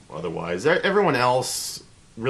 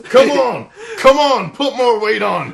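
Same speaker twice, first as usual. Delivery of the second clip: high, loud drill-sergeant bellow; roaring the guy on at the bench; hoorah colonel yell; 10 dB louder than usual. Retold or imitated. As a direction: bark at him like a gym-rat Marine. Colonel yelling in the gym.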